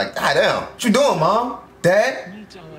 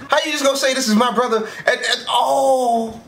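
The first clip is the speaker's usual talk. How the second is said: outraged shout; 6 semitones higher than usual. Real, not acted. Outraged at betrayal scene.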